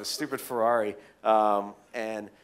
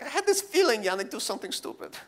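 high-pitched mock Russian accent; imitated Russian stepmother; 10 semitones higher than usual. Russian stepmother phoning about the flight, high mock Russian accent. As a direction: fret in a put-on Russian accent. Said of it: "my stepmother had called him".

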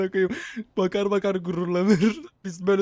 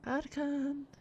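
man doing a woman's voice; 6 semitones higher, 14 dB quieter than usual. Pretend. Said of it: "Kadın sesi yapacaktım".

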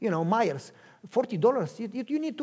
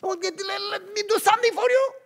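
high, loud, whiny plea; high desperate pleading; desperate begging; 13 semitones higher, 6 dB louder than usual. Acted. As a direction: beg with everything you have.

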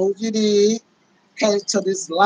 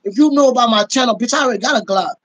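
retorting; 3 semitones higher, 6 dB louder, faster than usual. Retold or imitated. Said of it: "I was like".

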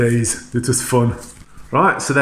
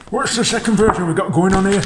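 much higher, playful Yorkshire voice; Yorkshire accent; 9 semitones higher, 4 dB louder than usual. Pretend. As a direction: put on a high-pitched Yorkshire accent. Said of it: "That's my Yorkshire voice".

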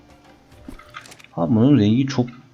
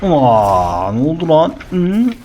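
surprised; 6 semitones higher, 5 dB louder, slower than usual. Real, not acted. Surprise after sneaky hit.

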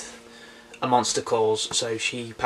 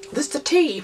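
squeaky high voice. Pretend. Silly high voice after cough.

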